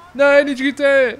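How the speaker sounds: high, loud pleading cry; frantic loud plea; panicked plea. Real, not acted. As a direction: beg them not to shoot. Begging not to be shot.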